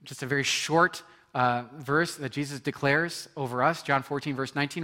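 ordinary talk

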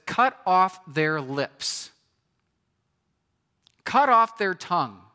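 slow, emphatic angry command; acted angry prayer, hitting each CUT hard; raw anger. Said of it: "That's how angry, that's how raw he is".

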